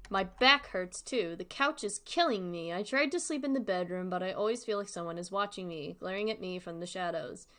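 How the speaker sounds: steady, lightly voiced horror reading; story narration. A narrator's reading. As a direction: read the diary entry to the audience. Reads the story aloud.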